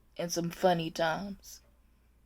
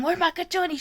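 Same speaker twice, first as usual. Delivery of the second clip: higher-pitched mock yell; imitated yelling; 9 semitones higher than usual. Aide mimics the patient going crazy, high mock yell. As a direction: imitate her frantic demand, pitched up. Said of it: "She just keeps yelling".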